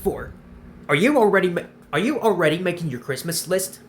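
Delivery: ordinary talk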